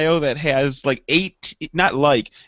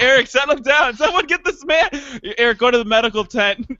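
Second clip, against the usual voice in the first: mock calling out; 7 semitones higher than usual. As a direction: pretend to be a worried staffer summoning help. Staff calling out for help.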